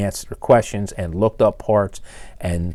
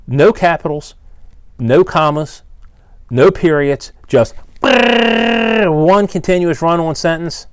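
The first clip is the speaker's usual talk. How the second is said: ranting; 7 semitones higher, 4 dB louder, slower than usual. Real, not acted. Ranting about sloppy letters.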